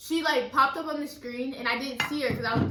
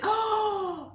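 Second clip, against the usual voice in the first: higher, startled oh; retold shocked gasp; 8 semitones higher than usual. Girl reliving the moment she recognised her. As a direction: gasp it out in surprise.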